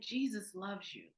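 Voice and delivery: very soft, gentle reassurance; hushed and loving, as if holding his hand; soft sincere blessing; tender. Real, not acted. Tender words to weeping man.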